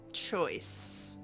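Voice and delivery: normal talking voice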